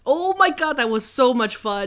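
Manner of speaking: excited. Real, not acted. Recalling fun German neighbours.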